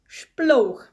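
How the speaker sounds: loud, high splash word; vivid, falling "splash"; demonstrating splash. A narrator's reading. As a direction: punch it out like a splash.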